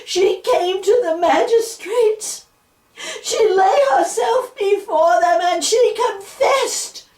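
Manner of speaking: put-on storyteller pleading the child's fate, near tears; impassioned storytelling. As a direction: tell the tragedy with rising grief. Dramatic witch trial telling.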